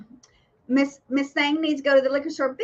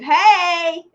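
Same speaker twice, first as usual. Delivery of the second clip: yelling; 6 semitones higher, 8 dB louder than usual. Retold or imitated. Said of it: "yelling at Dave".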